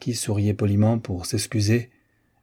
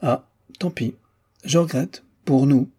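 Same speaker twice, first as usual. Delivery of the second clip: higher-pitched, stung regret; piqued; 5 semitones higher than usual. A narrator's reading. Miss Dixon turned down by the marquis, piqued. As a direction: say it nettled, with short pauses. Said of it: "avait l'air piqué".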